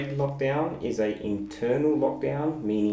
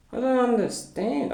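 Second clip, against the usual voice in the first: "I don't understand" said much higher with a rising end; exasperated confusion; 14 semitones higher, 3 dB louder than usual. Real, not acted. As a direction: pitch up in baffled exasperation. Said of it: "So I'm very confused".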